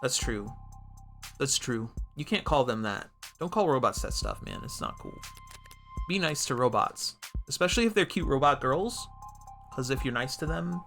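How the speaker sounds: ordinary talk, nothing marked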